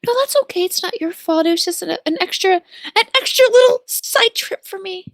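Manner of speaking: sweet character voice. Acted. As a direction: play it sweet and earnest.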